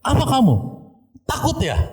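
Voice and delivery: very high mock yell, no real volume; teriakan orang kerasukan. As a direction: taunt like the possessed person, pitch thrown right up. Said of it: "dia teriak ke". (pura-pura berteriak mengejek, melengking)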